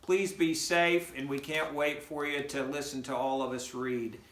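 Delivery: ordinary talk, nothing marked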